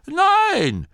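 very high, loud, drawn-out no; defiant denial. A narrator's reading. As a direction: contradict loudly in a high voice. Old man contradicts granny. (trotziger, hoher Widerspruch)

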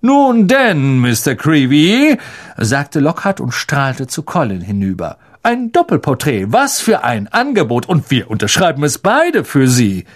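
beam it out grandly; beaming showman. Acted. Lockhart offers Colin a photo, gushing.

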